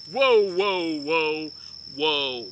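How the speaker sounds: ordinary talk, nothing marked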